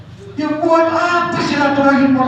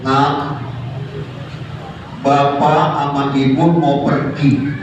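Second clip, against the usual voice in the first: ordinary talk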